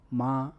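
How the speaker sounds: ma said high and level, held flat; ma in Mandarin first tone, high and flat. Shown as a demonstration. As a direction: sing ma on one high steady pitch. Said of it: "The first tone is a high flat sustained tone".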